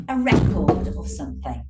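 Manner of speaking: a RE-cord of something, RE hit loud; a RECord, as a noun; stress on first syllable. Shown as a demonstration. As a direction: punch RE in record. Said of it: "is a noun".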